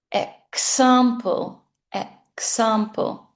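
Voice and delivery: "Example" slow with clear eks; slow model word. Shown as a demonstration. Teacher repeats "Example" slowly for the class. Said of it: "Repeat after me".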